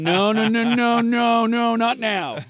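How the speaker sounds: high, loud frantic no no no; panicking like a toilet overflowing; mock panic. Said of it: "You're just going".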